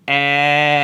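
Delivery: اے held long and loud on one note; long vowel. Shown as a demonstration. Teacher models vowel sounds for learners, long drawn vowel. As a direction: stretch اے into a long, steady open vowel.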